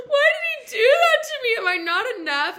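high, loud mock wail; gasp, then wail like a heartbroken drama queen; hammed-up meltdown. Islander losing it alone in her pod, mock wailing. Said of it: "I'd, like, lose my shit".

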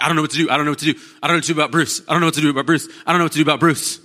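worried friend fretting aloud; fretting worry. Retold or imitated. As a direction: wring your hands over Bruce. Worried friend frets over Bruce.

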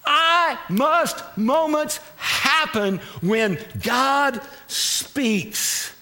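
drawn out word by word; half-speed emphatic pulpit statement; slow, weighty proclamation. Real, not acted. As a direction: let each word land on the congregation. States the sermon's key point.

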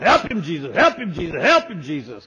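loud, fervent call of Help him, Jesus; church ladies calling out. Retold or imitated. Church ladies shouting to the young preacher, loud fervent call. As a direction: cry out like a church lady urging the preacher on. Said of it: "they were going".

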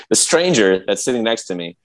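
normal talking voice

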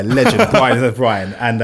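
ordinary talk, nothing marked

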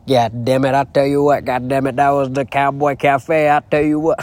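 hammed-up country farmer impression. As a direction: play a farmer cussing in amazement. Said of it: "acting like a farmer".

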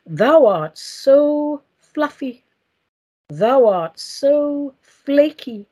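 very slow, grand mock-solemn ode; drawn-out theatrical poetry reading; poem recital. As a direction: declaim a silly ode, stretching each line with long pauses. Recites own comic poem.

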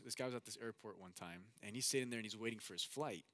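usual speaking voice

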